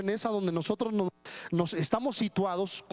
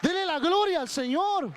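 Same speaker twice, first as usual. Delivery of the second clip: fiery preaching; 6 semitones higher, slower than usual. Real, not acted. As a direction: build to a shout of triumph over the demon. Preacher rising to climax.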